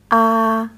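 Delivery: "ah" high and level like hua; first tone. Shown as a demonstration. Teacher repeating the high flat "ah" after hua. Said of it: "high and flat one".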